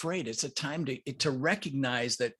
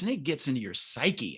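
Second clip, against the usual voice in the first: ordinary delivery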